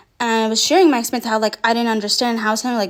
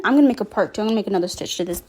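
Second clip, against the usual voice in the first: normal talking voice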